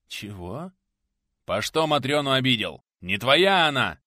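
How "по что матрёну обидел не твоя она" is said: loud, accusing challenge; angry confrontation. A narrator's reading. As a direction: confront him over the girl. Quarrel over the girl.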